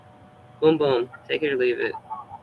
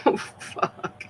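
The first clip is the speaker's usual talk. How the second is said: laughing; 12 semitones higher, slower than usual. Retold or imitated. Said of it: "It's like".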